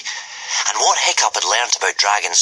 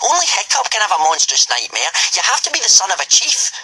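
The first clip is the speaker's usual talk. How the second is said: mocking retort; 12 semitones higher than usual. A narrator's reading. Speedy Fist mocking Snotlout's boast, scornful.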